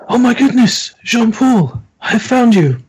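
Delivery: high excited rescuer voice; Henri excited. Acted. Game master voicing Henri finding his friend, high and excited.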